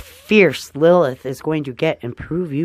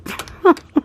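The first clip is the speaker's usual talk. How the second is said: high laugh. Real, not acted. Laughs about hair colour.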